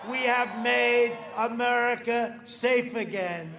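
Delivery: building rally crescendo, shouted; rally speech. Real, not acted. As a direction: rouse the crowd, each line bigger.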